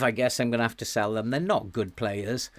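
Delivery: usual speaking voice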